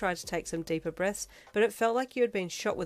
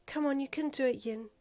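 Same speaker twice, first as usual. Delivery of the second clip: calm; 5 semitones higher than usual. A narrator's reading. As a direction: coach her calmly through breathing. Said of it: "she said calmly".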